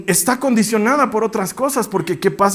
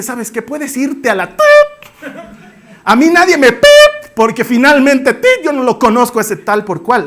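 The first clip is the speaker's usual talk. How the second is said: much louder, higher furious rant; angry cursing; 5 semitones higher, 5 dB louder than usual. Acted. Peter cursing at the woman who recognised him, loud and furious. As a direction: lash out at the servant girl.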